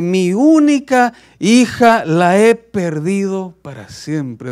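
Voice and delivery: loud, slow wail that fades at the end; anguished shout. Acted. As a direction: cry out the loss, then break off. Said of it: "a gritar". (lamento fuerte y lento que se apaga)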